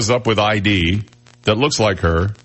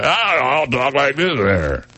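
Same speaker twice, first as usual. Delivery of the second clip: higher, slow mocking drawl of Missouri talk; 6 semitones higher, slower than usual. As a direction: lampoon how folks in Missouri sound, slowly. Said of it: "They don't talk like normal".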